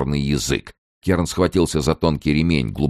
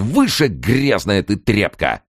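higher, slow, drawn-out fierce order; raging insult; 6 semitones higher, slower than usual. A narrator's reading. Kern raging at the man below, contemptuous yell. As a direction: heap contempt on him, stretching the words. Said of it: "буйствовал Керн".

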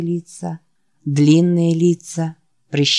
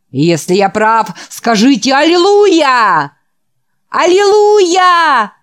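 slow, loud, rising to a high Аллилуйя; building hallelujah call; 8 semitones higher, 6 dB louder than usual. Acted. The preacher leads the ecstatic boys in a hallelujah. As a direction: lift the crowd into the cry.

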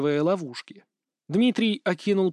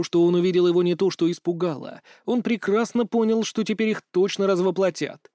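plain reading voice

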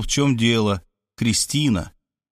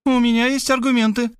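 high-pitched, even woman's voice; unruffled, lightly voiced woman; unperturbed; 12 semitones higher than usual. A narrator's reading. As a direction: state it coolly in a lifted voice. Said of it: "невозмутимо сообщила она".

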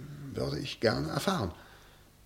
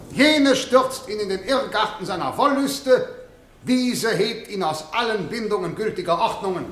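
raised courtroom oratory, addressing the jury; prosecutor's closing plea; 8 semitones higher than usual. Acted. Prosecutor addresses the jurors at the trial, in lofty courtroom oratory. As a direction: hammer the charge home to the jurors.

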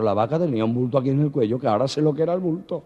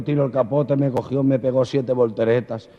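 ordinary talk, nothing marked

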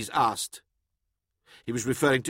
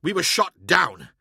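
higher, restrained indignation; contained anger; 4 semitones higher, 4 dB louder than usual. A narrator's reading. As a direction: hold the anger just in check. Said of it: "could barely contain his anger".

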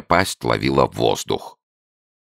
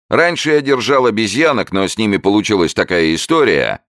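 quizzing showman; 8 semitones higher, 4 dB louder than usual. A narrator's reading. Showman quizzing his newt, high loud barker. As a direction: test the beast for the crowd. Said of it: "пояснил он".

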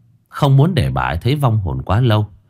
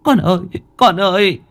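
weeping mother calling; 10 semitones higher than usual. Acted. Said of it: "nước mắt như mưa".

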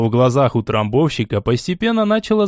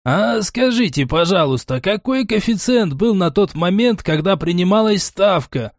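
high-pitched, ingratiating courtesy; unctuous; 7 semitones higher than usual. A narrator's reading. Утрамбовщик asks Глайдер for the odds, unctuous. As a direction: coax it out with oily politeness. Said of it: "елейным голосом произнёс утрамбовщик".

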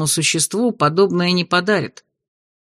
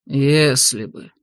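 displeased; 6 semitones lower, much slower than usual. A narrator's reading. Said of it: "В интонациях степняка промелькнуло недовольство".

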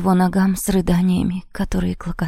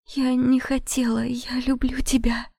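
tearful plea; 5 semitones higher than usual. Acted. Heroine pleads in tears.

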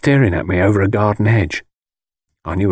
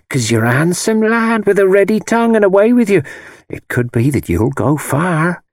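wheedling; 10 semitones higher than usual. A narrator's reading. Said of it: "went on in a wheedling voice".

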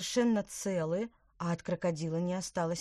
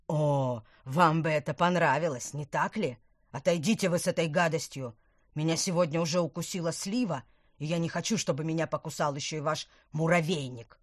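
angry; 6 dB louder than usual. Acted. Disgusted man snaps at offer.

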